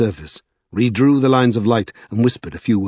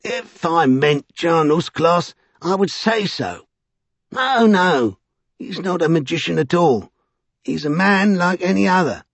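much higher, drawn-out exasperation; exasperated Vinculus; 9 semitones higher than usual. A narrator's reading. Vinculus correcting Childermas, high and exasperated. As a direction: insist as if to a slow pupil. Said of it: "Vinculus made a noise of exasperation".

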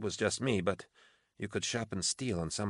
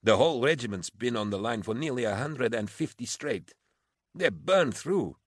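heated, pitched-up complaint; exasperated protest; 5 semitones higher than usual. A narrator's reading. Officer protesting for her worn-out regiment, exasperated. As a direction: argue it in frustration.

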